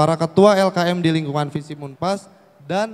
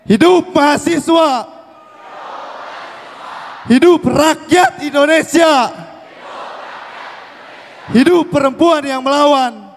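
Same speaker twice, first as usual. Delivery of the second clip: rallying slogan shout; 8 semitones higher than usual. Real, not acted. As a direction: shout each slogan to rouse the crowd. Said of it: "dan berteriak". (teriakan yel perjuangan yang lantang)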